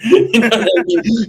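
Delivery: high, laughing question. Real, not acted. Cracking up at his own cop joke.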